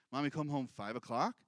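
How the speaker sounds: low, questioning imitation of the son; lightly voiced son asking when mom returns. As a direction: ask it like the boy, rising at the end. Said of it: "He'll actually say".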